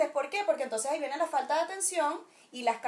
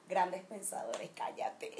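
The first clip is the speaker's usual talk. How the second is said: whisper; 6 semitones lower, 8 dB quieter, slower than usual. Real, not acted. Whispered self-mocking aside.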